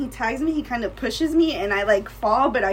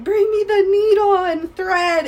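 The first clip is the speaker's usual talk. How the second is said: high, loud mock command; acted-out stepdad, raised voice; imitated stepdad's order; 8 semitones higher, 7 dB louder than usual. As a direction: call out for supplies like an urgent order. Stepdad ordering home stitches.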